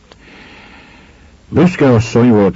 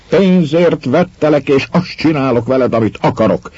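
the repeat thrown out higher and harder; retold shout; 6 semitones higher than usual. Innkeeper whipping his wife before everyone, a higher retold shout. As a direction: let the innkeeper's cry swell on the second go. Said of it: "kiáltott Reá".

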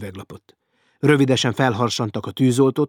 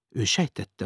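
plain reading voice

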